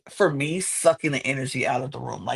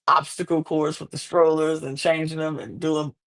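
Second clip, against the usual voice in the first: ordinary talk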